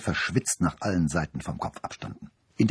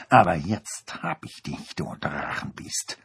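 growling dog voice. A narrator's reading. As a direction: growl then breathe the threat. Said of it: "knurrte sie".

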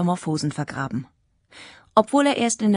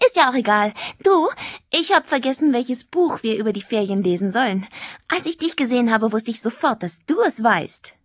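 put-on raised, lively girl's voice; chatty schoolgirl; 5 semitones higher than usual. Tanja asks Jack about the holiday book, chatty schoolgirl. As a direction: chatter eagerly as a teenage girl.

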